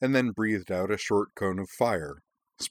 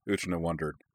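usual speaking voice

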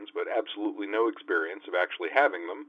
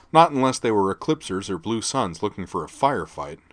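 ordinary delivery

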